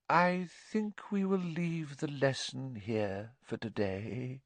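faraway fortune-teller, hushed and drawn out; misty voice. A narrator's reading. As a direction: float the words out dreamily. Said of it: "in her mistiest voice".

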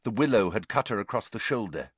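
ordinary delivery, nothing marked

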